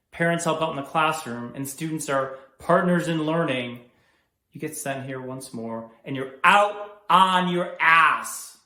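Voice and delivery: loud, emphatic reprimand; stern scolding. Acted. School principal scolding a student for foul language, angry. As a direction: lay down the law, building to a loud finish.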